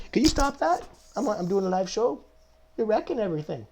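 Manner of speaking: very high sing-song pet voice; talking to dog. Real, not acted. Host telling his dog to quit the squeaky toy, high pet voice. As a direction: coo at the dog to stop squeaking. Scolding dog during live show.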